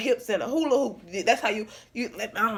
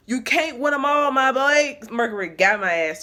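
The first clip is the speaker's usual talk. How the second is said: normal talking voice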